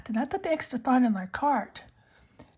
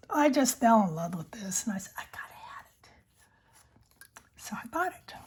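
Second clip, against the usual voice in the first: unvoiced ASMR whisper. Real, not acted. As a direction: breathe the words close to the mic. Whispered ASMR pen chat.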